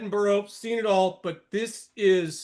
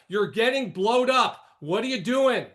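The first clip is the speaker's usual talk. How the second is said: usual speaking voice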